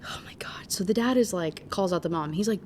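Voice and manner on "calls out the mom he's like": normal talking voice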